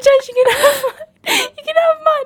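put-on quoting boyfriend, high and crying with laughter; laugh-crying quote. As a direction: imitate his plea through laugh-tears. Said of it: "he's like".

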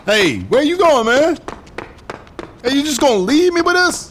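hey, don't leave me holding this; calling after him. Acted. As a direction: yell after the courier as he walks off. Calling after departing courier.

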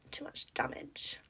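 breathy whisper, half to herself; whispered aside. Real, not acted. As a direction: drop to a whisper for the joke.

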